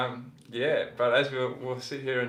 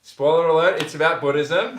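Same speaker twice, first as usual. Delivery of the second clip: loud, higher, laughing announcement; laughing reveal; 6 semitones higher, 11 dB louder than usual. Real, not acted. Guest revealing his Buddhism to viewers, laughing loudly. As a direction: blurt it out through a laugh. Joking reveal to friends watching.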